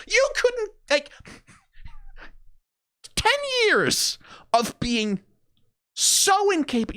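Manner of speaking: can't believe ten years of this; incredulous, disbelieving. Real, not acted. Creator incredulous at Riot's indecision, laughing in disbelief.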